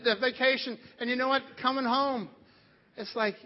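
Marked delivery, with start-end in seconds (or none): none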